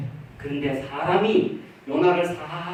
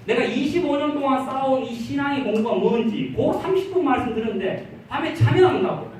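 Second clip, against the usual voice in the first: upset complaint; 6 semitones higher than usual. Retold or imitated. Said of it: "막 화를 내요".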